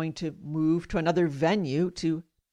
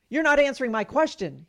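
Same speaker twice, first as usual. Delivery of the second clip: louder, higher irritated doctor; imitated snapping; 5 semitones higher, 5 dB louder than usual. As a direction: snap it like an impatient doctor. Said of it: "he snapped".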